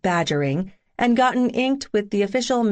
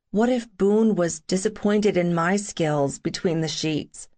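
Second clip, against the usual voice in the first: usual speaking voice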